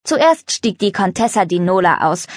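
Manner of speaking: ordinary delivery, nothing marked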